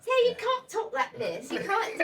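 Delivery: high, playful put-on London accent. Shown as a demonstration. Host challenges guests to copy her put-on London accent. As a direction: show off a mock London accent, slowly. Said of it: "What is, what is that, London".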